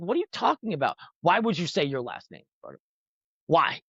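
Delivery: high, pleading disbelief from the homie; a friend who can't believe what he just heard; incredulous friend. Retold or imitated. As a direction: act baffled, begging him to explain. Friend scolding him in disbelief.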